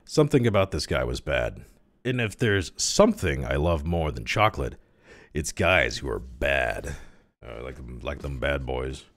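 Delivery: usual speaking voice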